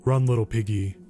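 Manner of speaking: low, menacing singsong taunt. A narrator's reading. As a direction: tease the prey from behind. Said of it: "the man taunted".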